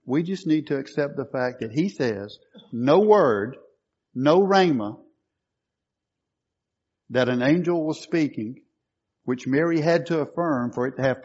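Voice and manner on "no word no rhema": "No word, no rhema" proclaimed loud; stress on word and rhema. Shown as a demonstration. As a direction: press "word" and "rhema" firmly. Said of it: "that's why I'm emphasizing it".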